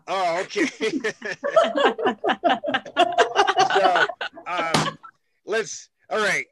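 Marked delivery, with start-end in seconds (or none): 0.7-3.7 long, high, loud belly laugh; helpless, pealing laugh; real laugh. Real, not acted. Laughing at guest's freaky-dude joke.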